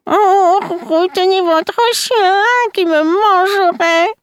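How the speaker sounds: put-on very high wailing old-woman voice; vieille fée. A narrator's reading. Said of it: "La vieille lui dit". (plainte suraiguë de vieille fée)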